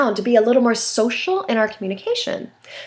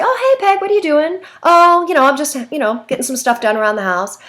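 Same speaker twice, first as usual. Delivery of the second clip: chatty Dixie; 7 semitones higher than usual. Retold or imitated. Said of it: "she'll say".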